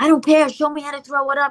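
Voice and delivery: reenacted desperate mum; frantic begging. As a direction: plead urgently and brush off any objection. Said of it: "I'm begging my anorexic daughter".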